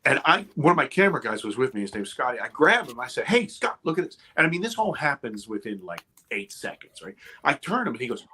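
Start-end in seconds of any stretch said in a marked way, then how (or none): 3.2-4.2 high-pitched, urgent alert to a friend; retold urgent call. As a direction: get his attention fast, voice jumping up.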